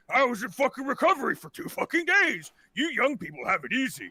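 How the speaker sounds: put-on old man's indignant complaining; cranky old man. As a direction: grouse like a fed-up ninety-year-old. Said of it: "he looks about ninety years old".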